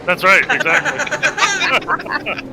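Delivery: laughing. Real, not acted. Laughing after the accent joke.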